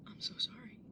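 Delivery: breathy whispered sorry; whispered apology. Acted. Brother whispering sorry to his sister. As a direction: whisper it, ashamed.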